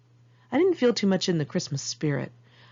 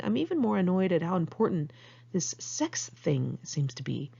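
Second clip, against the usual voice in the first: ordinary talk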